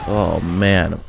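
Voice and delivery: ordinary talk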